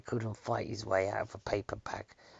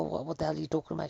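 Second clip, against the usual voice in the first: fast, higher mock outrage; retold angry outburst; 7 semitones higher, much faster than usual. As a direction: rattle it off as if offended. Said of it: "I got really angry".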